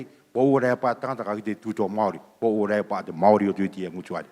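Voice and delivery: usual speaking voice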